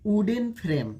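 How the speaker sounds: "वुडन" with a clear w glide, "wooden"; correct pronunciation. Shown as a demonstration. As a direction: say "वुडन frame" with w at the start. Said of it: "अब देखिए इसको क्या पढ़ेंगे". ("वुडन" को व की ध्वनि से बोलें)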